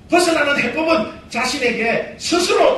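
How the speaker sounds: ordinary talk, nothing marked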